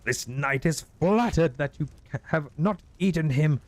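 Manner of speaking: slow, very high courtly voice; bow and offer your post; high-pitched mock-chivalrous knight; gallant knight.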